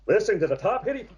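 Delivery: hammed-up DJ announcing the hit parade; radio DJ voice. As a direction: put on a quick, high radio jock voice. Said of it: "we would call it the radio voice".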